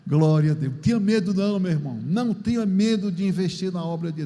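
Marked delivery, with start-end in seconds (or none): none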